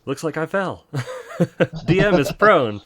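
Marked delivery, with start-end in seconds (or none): 1.4-2.8 laughing through the words. Real, not acted. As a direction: giggle while saying it. DM laughs after falling.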